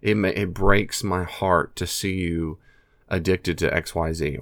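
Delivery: ordinary talk, nothing marked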